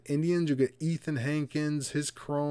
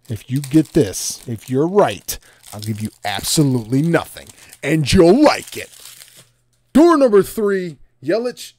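game-show host; 4 semitones higher, 18 dB louder than usual. Pretend. Streamer teases Bruce with door three, booming host voice. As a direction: build to a high, shouted prize reveal.

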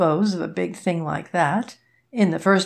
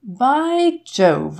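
sudden higher, louder outburst; exclaiming; 6 semitones higher, 4 dB louder than usual. A narrator's reading. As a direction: burst out with the realisation. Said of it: "I exclaimed".

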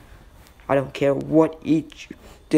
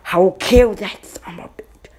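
furious scream, much louder and higher; 5 semitones higher, 7 dB louder than usual. A narrator's reading. Naruto screams his threat at Madara, furious. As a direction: scream it in pure rage. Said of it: "Naruto screamed out".

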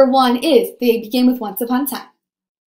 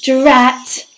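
annoyed fairy; 4 dB louder than usual. Acted. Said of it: "thought the fairy".

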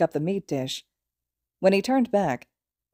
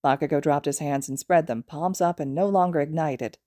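ordinary delivery, nothing marked

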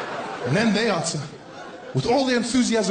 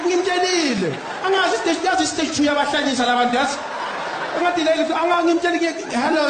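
exasperated rant; 8 semitones higher, faster than usual. Acted. Caller rants in Zulu about Doris to nobody, exasperated and fast. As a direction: rant in Zulu, fed up and laughing.

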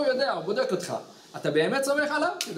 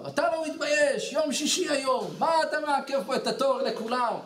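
mock angry yelling; 7 semitones higher than usual. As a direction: imitate an indignant customer telling someone off. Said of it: "התחיל לצעוק עליו".

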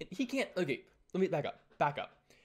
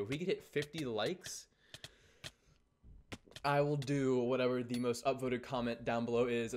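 ordinary talk

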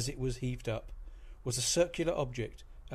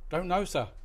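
loud called reply; shouting back; 6 semitones higher, 5 dB louder than usual. A narrator's reading. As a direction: shout it across the deck. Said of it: "the third mate shouted back".

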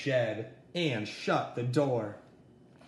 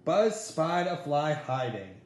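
ordinary delivery

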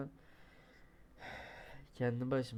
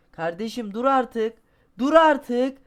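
high, loud desperate yelling; frustrated shout; 11 semitones higher, 16 dB louder, slower than usual. Real, not acted. Gamer yelling at his falling climber, frustrated. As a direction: scream at the game to stop.

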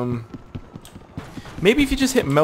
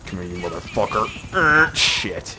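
usual speaking voice

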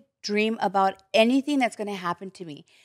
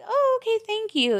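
sugary fake thanks; 13 semitones higher than usual. Retold fake gratitude.

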